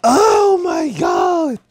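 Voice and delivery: high, slow laughing cry. Real, not acted. Streamer cracking up at his own joke. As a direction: wail it out while laughing.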